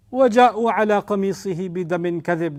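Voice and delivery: fast Arabic verse recitation; Quran recitation. Real, not acted. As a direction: recite the verse swiftly, chanted.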